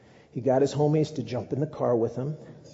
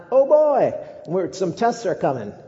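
much higher and louder, hammed-up alarm; mock dismay; 8 semitones higher, 8 dB louder than usual. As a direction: squeal it like tests just hit you.

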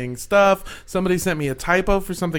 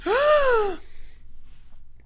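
mock gasp. Reacts to a sound in the distance.

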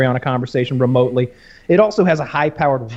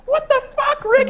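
much higher outraged squeak, laughing; imitating shocked Morty. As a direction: squeal it in disbelief. Morty voiced.

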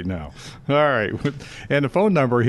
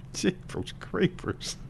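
whispered exclamation; 9 semitones higher, 8 dB quieter, much slower than usual. Real, not acted. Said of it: "repeated, whispered and slow".

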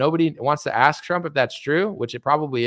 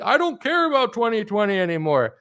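higher, dismissive mock of a fan's excuse; mocking supporter; 5 semitones higher than usual. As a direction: toss it off like a careless fan.

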